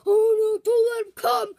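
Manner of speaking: loud, alarmed cry; panicked plea. Pretend. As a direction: beg in a panic. Afraid the boss is coming.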